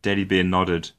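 ordinary delivery, nothing marked

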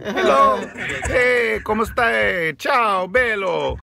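sleazy agent greets his client warmly; agent Salvatore greeting. Pretend. Agent Salvatore greets Jimmy, loud showy hello.

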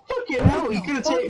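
ordinary talk, nothing marked

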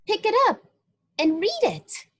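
put-on high, light child's voice calling out; a young child speaking up from somewhere unseen. A narrator's reading. Narrator voicing a child telling a man to read. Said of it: "He heard a child say".

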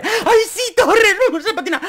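high, loud, laughing squeal; an overexcited mum squealing with delight; gushing mother. Retold or imitated. Torres's mother gushing at her son, high laughing squeal. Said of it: "y la madre".

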